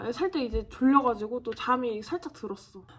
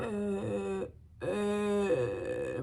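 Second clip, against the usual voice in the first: groggy moan; 4 semitones lower, 6 dB quieter, much slower than usual. Retold or imitated. Delirious groaning in recovery.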